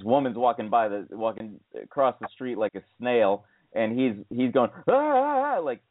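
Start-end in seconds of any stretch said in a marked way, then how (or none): 4.9-5.6 high mock scream, ah ah ah; imitated screaming. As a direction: squeal it like a reckless biker clearing the road. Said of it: "uh, screaming".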